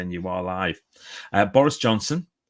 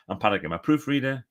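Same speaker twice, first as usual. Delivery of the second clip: normal talking voice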